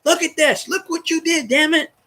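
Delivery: high, put-on voice scolding an old friend; squeaky pretend telling-off of the quarterback who left; mock scolding. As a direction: wag a finger at him in a high mock voice. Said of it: "And I'm gonna say".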